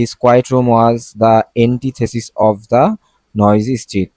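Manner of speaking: each word read out slowly and deliberately; slow example reading. Shown as a demonstration. Teacher reading an example sentence to students, slow and deliberate. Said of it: "reads example sentence slowly".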